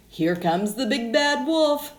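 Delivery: ordinary delivery, nothing marked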